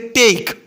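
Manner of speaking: ટેક with an "ay" vowel between t and k. Shown as a demonstration. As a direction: draw out the "ay" in ટેક. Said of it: "આનો ઉચ્ચાર થશે".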